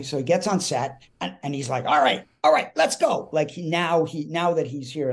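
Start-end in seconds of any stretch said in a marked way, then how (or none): 1.8-3.3 loud, high call to get moving; bossy Mickey Rooney. Retold or imitated. Mickey arrives on set and takes over, bossy and loud. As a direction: take charge, pitched up and pushed out. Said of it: "now he's running the show".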